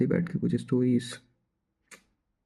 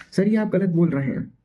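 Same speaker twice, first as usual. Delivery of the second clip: loud, raised pitch, standing up to the teacher; defiant objection; 6 semitones higher, 5 dB louder than usual. Retold or imitated. Student loudly telling the sir he is wrong, defiant. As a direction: push back on the teacher, loud and firm.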